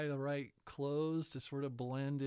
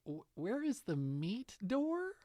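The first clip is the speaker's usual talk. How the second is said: baffled son; 9 semitones higher than usual. Retold or imitated. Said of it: "my son, said".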